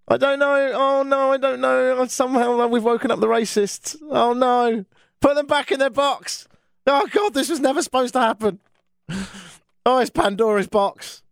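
high-pitched wail of mock despair; mock dismay. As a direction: wail it high, as if disaster has been let loose. Mock panic at waking up racists.

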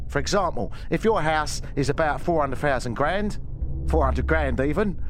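menacing Cockney gangster explains realtors over ominous music; Bricktop impression. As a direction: lecture like a menacing Cockney hard man. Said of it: "maybe I should do a bit of Bricktop".

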